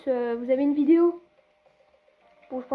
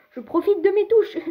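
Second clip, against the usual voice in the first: higher and louder burst of enthusiasm; excited; 7 semitones higher, 6 dB louder than usual. Real, not acted. Gamer telling viewers he only enjoys pressing the buttons, excited and louder. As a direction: punch it out, keen on his buttons.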